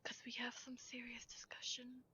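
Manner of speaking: soft secretive whisper. Acted. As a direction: lean in and whisper it. Character whispering to companion.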